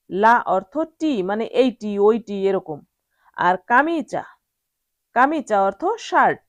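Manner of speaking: ordinary delivery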